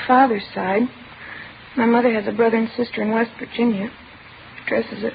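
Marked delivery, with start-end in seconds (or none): none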